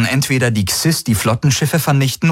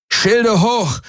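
much higher shouted command; bellowed order; 13 semitones higher than usual. Acted. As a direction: bark the order to raise shields. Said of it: "brüllte Garland".

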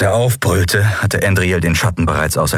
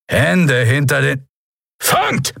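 called-out command; 6 semitones higher, slower than usual. A narrator's reading. Guard ordering a captive, then a rescuer's call, shouted. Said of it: "rief eine tiefe Stimme".

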